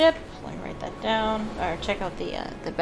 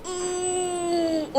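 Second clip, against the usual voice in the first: high, drawn-out wincing moan; long high groan of vicarious pain; pained "ooh"; 9 semitones higher than usual. Real, not acted. Wincing at a painful dialogue option.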